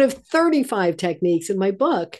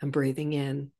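soft inner thought; 5 semitones lower, 6 dB quieter than usual. Shown as a demonstration.

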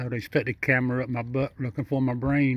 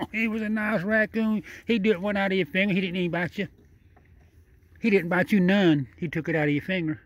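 delighted praise; 6 semitones higher than usual. Real, not acted. Praising raccoon after it took food.

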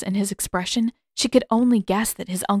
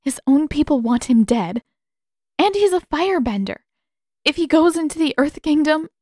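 upset protest; 7 semitones higher, 5 dB louder than usual. Acted. Katara argues for Zuko's safety.

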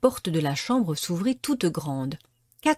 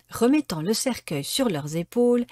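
usual speaking voice

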